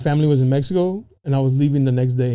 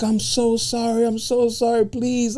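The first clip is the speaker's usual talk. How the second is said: imitating crying woman; 8 semitones higher, slower than usual. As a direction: mimic a sobbing driver begging forgiveness. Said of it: "she's crying".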